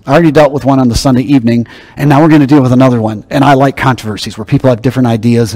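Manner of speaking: usual speaking voice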